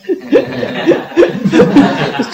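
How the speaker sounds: a high, loud burst of laughter. Real, not acted. The master laughs at his own praise of the doctor, loud and high.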